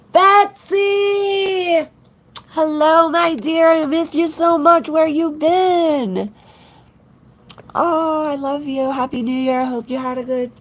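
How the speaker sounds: high, loud, delighted squeal of welcome; light up like a long-lost friend walked in; squealing greeting. Real, not acted. Greeting her dear viewer Betsy, loud high squeal.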